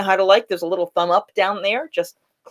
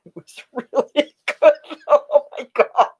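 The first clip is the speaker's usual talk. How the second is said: laughing; 8 semitones higher than usual. Real, not acted. Laughing at the memory.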